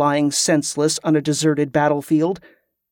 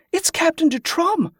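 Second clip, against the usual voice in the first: high-pitched excited exclamation; astonished recognition; 13 semitones higher than usual. A narrator's reading. Character recognises the captain.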